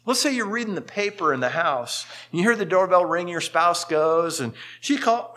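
ordinary delivery

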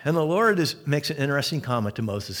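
normal talking voice

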